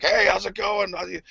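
high-pitched friendly greeting, imitated; lightly hammed-up cheery hail; Tom Atkins. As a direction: mimic an upbeat hello to a fan. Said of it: "that guy was totally like".

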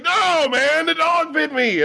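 high, whining complaint; whiny Kevin. Retold or imitated. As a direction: play him as a pitiful whiner. Kevin's voice in a retold story.

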